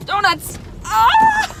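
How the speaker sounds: high, loud thrilled scream; joyful scream; excited shriek. Real, not acted. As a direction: squeal as the car spins. Spinning donuts in the car.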